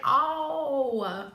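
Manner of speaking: drawn-out, put-on character groan; trying out a Scrooge voice. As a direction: test an old miser's voice on a long "oh". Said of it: "I don't like that voice for Scrooge".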